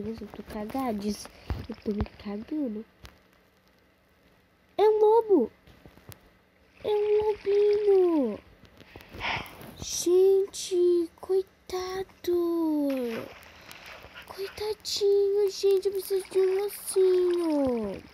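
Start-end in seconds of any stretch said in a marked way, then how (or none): 4.8-8.4 excited cooing. Real, not acted. Sees a cute wolf. 9.8-18.0 tender pity. Real, not acted. Pities the wolf, drawn out.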